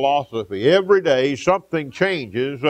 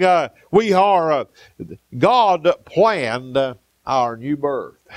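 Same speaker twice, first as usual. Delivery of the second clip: ordinary talk, nothing marked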